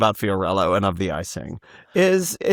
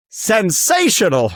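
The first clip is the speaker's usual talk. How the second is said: higher, drawn-out "sensational"; quoted catchphrase; 8 semitones higher, slower than usual. Retold or imitated. Host quoting Cola Scola, drawn-out flourish. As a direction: stretch it out with high flourish. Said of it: "to quote Cola Scola".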